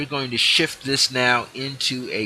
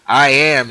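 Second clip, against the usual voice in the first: "I am" louder and higher; emphatic; 3 semitones higher, 10 dB louder than usual. Shown as a demonstration. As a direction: sound "I am" like a holy name. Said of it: "the mystic name of God".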